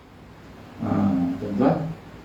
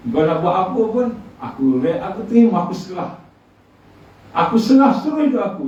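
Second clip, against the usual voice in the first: pleading surrender; 8 semitones higher, 3 dB louder than usual. Retold or imitated. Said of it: "Lepas tu saya kata".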